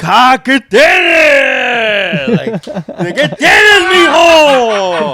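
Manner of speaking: very high, loud, wailing Spanish father; panicked dad. Retold or imitated. Dad woken at night, wailing high and loud at his son. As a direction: wail it like a frightened dad. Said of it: "then my dad's like".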